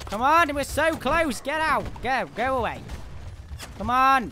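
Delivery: high, loud urging; real battle cry; fired-up shout. Real, not acted. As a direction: yell at the team to push. Urging team in fight.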